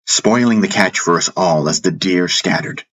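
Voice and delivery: usual speaking voice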